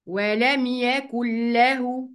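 very slow "ولم يكن له" as wa-lam yakull-lahu; nun merged into lam. Shown as a demonstration. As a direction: hold a doubled l in "يكن له". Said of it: "هلغي النون".

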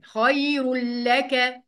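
"خير لك" slowly chanted khayrul-laka; idgham without ghunna. Shown as a demonstration. Teacher showing how it is pronounced, "خير لك" merged into the lam. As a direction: drop the nun in "خير لك" and double the lam. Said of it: "نطقت اللام".

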